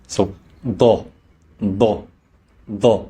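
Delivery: "da" with a heavy, emphatic d from the throat; deep throat da. Shown as a demonstration. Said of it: "D coming from deep in the throat".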